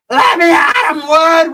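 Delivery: very high, loud squealing shout; hammed-up battle cry. Dwarfwood jumping into the fight, mock-frantic shout. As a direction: scream it like a tiny fighter charging in.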